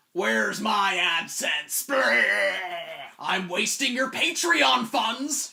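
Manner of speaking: loud, drawn-out mock monster wail; dead channel. YouTuber dressed as a dead channel moaning about AdSense, hammy ghoulish wail. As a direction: howl it out as a hammy undead creature. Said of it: "A dead channel".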